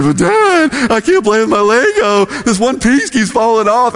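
high-pitched whiny mock wail; hammed-up little boy melting down; imitated crying kid. Young son wailing to Dad over his Lego, mock crying. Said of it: "he just started crying".

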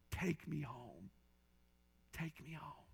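a quiet, broken request to go home; hushed, tearful whisper of a lost girl; tearful plea. Retold or imitated. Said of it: "tears began to flow".

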